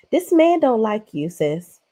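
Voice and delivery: normal talking voice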